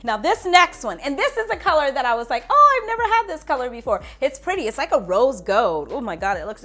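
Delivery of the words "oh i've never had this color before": high, louder gushing exclamation; excited reaction. Retold or imitated. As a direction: re-enact a delighted first look, pitched up. Reaction quote on seeing a colour.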